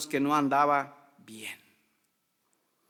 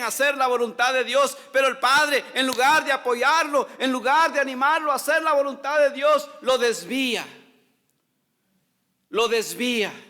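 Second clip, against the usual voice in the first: loud, higher impassioned sermon; fiery preaching; 6 semitones higher, 5 dB louder than usual. Acted. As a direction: preach it out to the whole congregation. Preaching at full force. (predicación fervorosa, fuerte y alta)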